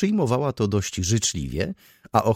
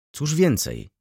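higher-pitched eager prompt; impatient, lifted question; insisting; 7 semitones higher, slower than usual. A narrator's reading. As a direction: press for more. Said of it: "nalegał Ochocki".

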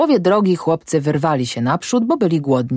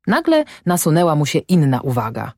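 ordinary delivery, nothing marked

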